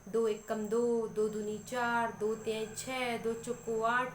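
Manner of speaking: drawn-out, sing-song rote counting; slow recitation of Hindi tables. Shown as a demonstration. Mother showing kids how Hindi tables are recited, slow chant. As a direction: recite each table line slowly like a classroom chant. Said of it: "बच्चा को नहीं आता है".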